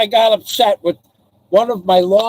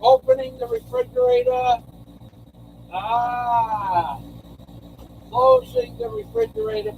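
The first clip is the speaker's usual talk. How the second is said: much higher, softer play-by-play of his own actions; mock announcer narration; 11 semitones higher, 7 dB quieter than usual. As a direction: call each move like a silly commentator.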